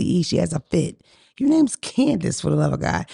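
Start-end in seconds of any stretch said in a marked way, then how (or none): 1.4-2.4 high, slow exasperation at Candace; exasperated scold. Real, not acted. Telling her cousin off over her name, slow and exasperated. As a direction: stretch it out, fed up with her.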